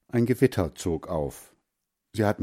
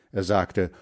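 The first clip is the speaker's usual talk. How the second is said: usual speaking voice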